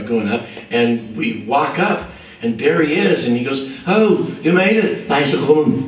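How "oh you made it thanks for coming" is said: lightly voiced, higher friendly host; a warm star welcoming the band, slowed and higher. [name] greeting them.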